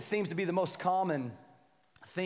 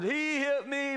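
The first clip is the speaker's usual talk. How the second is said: put-on whiny child; 8 semitones higher, slower than usual. Kids called upstairs after a fight.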